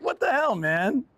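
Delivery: high mock what the hell; lightly mocking his own dismay; exasperated disbelief. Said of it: "it was just like".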